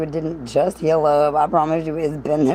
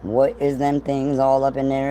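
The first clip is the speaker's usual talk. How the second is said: usual speaking voice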